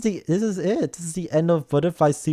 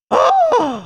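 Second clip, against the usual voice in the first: high sharp gasp of delight; sharp excited gasp; 4 dB louder than usual. Real, not acted. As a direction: gasp in shock at the kiss. Kiss scene reaction.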